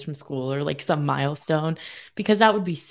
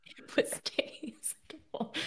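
breathy unvoiced whisper, slow; mock whisper; 9 semitones higher, 11 dB quieter, slower than usual. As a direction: whisper it back like a secret. Echoing the host's remark.

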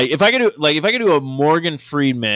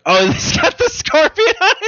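high laughing. Real, not acted. Laughing remark.

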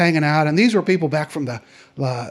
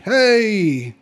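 stretched-out Texan greeting, pitched up; Texas drawl; 4 semitones higher than usual. Acted. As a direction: imitate a big Texas drawl. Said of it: "He had this Texas drawl".

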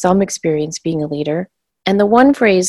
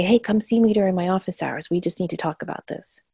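hushed, fading softer toward the end; quiet, private aside; 9 dB quieter than usual. Retold or imitated. Teacher privately calling a student to office hours, hushed. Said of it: "I'd go up and I'd whisper quietly".

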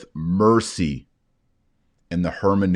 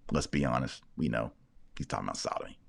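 hushed aside; 7 semitones lower, 11 dB quieter, faster than usual. Real, not acted. Repeats the claim, lower and hushed.

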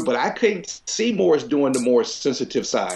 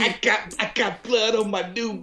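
higher hammed-up wounded complaint; overacted death scene; 8 semitones higher, 3 dB quieter than usual. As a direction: moan about the ruined coat, overplayed. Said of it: "saw Morris do the death scene".

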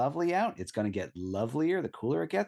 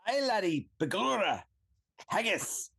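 hammed-up mock Scottish brogue, higher; 7 semitones higher than usual. Guest greets the host in a joking mock Scottish accent. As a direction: play a cartoon Scotsman greeting a friend in Scottish. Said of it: "Begorra is absolutely Irish, not Scottish".

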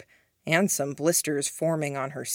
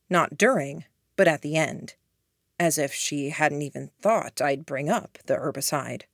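plain reading voice

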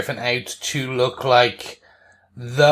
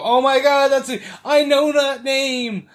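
mock giddy recognition, much higher; excited fan; 12 semitones higher than usual. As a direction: gush like a fan spotting a reference. Voices thrilled fans recognizing a name.

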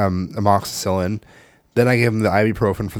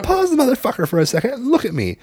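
higher, laughing through the order; cracking up while scolding; losing it, laughing; 13 semitones higher than usual. Retold or imitated. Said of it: "and I lost at this point".

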